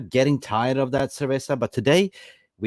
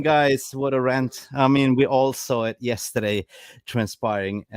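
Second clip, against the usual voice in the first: ordinary talk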